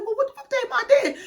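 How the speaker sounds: high, fast, laughing disbelief; what did she even do, high and laughing; baffled outburst. Retold or imitated. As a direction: squeal it out in laughing confusion. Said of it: "I'm like".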